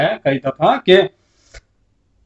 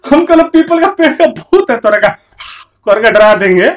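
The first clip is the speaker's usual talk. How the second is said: mock spooky threat; 7 semitones higher, 5 dB louder than usual. Threatening to scare as ghost.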